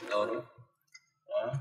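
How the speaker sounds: ordinary talk, nothing marked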